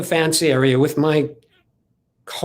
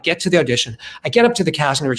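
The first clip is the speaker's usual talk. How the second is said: usual speaking voice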